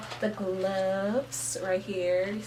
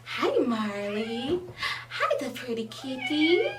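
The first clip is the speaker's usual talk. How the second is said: slow, loud cooing to a cat; pet voice; 4 semitones higher, 5 dB louder, slower than usual. Real, not acted. Owner greets her cat in a cooing pet voice. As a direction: coo at the kitty.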